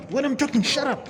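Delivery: high-pitched caricature of a bossy cop; mocking the cop. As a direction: parody the rude officer cutting him off. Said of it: "He goes like".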